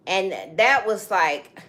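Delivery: ordinary delivery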